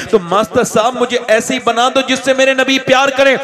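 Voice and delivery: builds to a high ringing shout; booming rally speech. Acted. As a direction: preach it at full force, climaxing. Rising to booming climax. (ज़ोरदार जोशीली तक़रीर)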